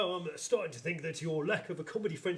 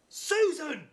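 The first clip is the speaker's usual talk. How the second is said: louder, higher startled call of her name; shocked cry; 7 semitones higher, 6 dB louder than usual. Acted. Inspector finally spots the victim on the floor, shocked. Discovers body.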